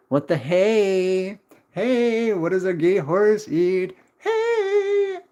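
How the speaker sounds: hammed-up high joke delivery, a squealed Hey at the end. Streamer tells chat a horse joke, high hammed-up punchline. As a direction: ham up the punchline.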